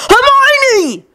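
high frantic scream. A narrator's reading. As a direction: scream her name in panic. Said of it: "Ron screamed".

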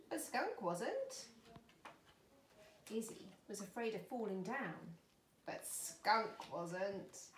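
picture-book reading with drawn-out line endings; story narration. Acted. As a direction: play up each contrast for small children. Reads a picture book to children.

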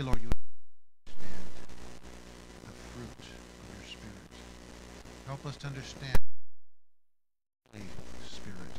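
earnest spoken prayer, swelling to loud cries. Real, not acted. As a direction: pray aloud to God, building from hushed to fervent. Pastor leads the congregation in prayer.

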